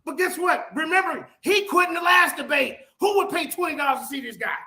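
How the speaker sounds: high-pitched, indignant rant; agitated, voice pushed up high; heated accusation. Real, not acted. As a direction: hurl it at your opponent. Attacking opponent in heated quarrel.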